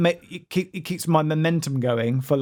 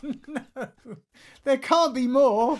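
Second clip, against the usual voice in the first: higher, slow groaning disbelief; mock dismay; 9 semitones higher, slower than usual. Real, not acted. Reacting to another AirPods episode with higher, slow mock dismay. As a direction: pretend to be overwhelmed. Friend teased about AirPods saga.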